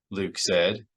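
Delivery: ordinary delivery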